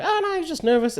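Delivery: light high soothing woman's voice, lightly voiced; a hint of Sharon cooing to calm him; imitating Sharon. Sharon defending the guy.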